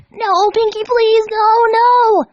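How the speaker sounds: rising to a high, loud scream; desperate begging. Acted. Dash begging before hammer.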